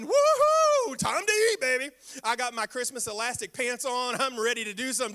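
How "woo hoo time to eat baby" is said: high, loud excited cheer; loud hungry celebration; excited whoop. Retold or imitated. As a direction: whoop like dinner is finally ready. Celebrating food being ready.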